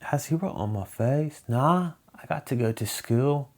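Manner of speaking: high imitation of a British girl; regional British accent. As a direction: mimic a regional British schoolgirl. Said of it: "that accent is actually really fun".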